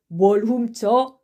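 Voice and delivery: loud, slow challenging question; heated scoffing retort. Acted. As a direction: throw it back, drawn out. Accuser throws question back.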